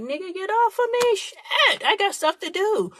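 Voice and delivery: high, exasperated mock voice, done with it; fed-up squeal telling him to get off; annoyed brush-off. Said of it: "and I'm sitting there like".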